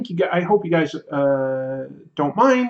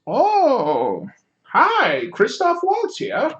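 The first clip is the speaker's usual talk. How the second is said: much higher, slow, louder suave mock greeting; Christoph Waltz; 8 semitones higher, 3 dB louder, much slower than usual. Viewer names an actor, streamer does high mock greeting. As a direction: lift the voice way up for a silly suave hello. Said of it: "Uh, Christoph Waltz".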